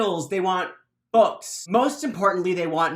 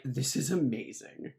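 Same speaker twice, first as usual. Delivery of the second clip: softer, lower deadpan delight; 5 semitones lower, 7 dB quieter than usual. Acted. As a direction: mutter it, unbothered by the panic. Mat delighted amid panic.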